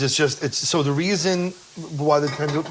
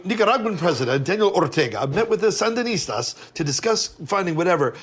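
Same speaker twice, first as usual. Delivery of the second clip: ordinary talk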